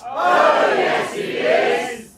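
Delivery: panto crowd callback. Acted.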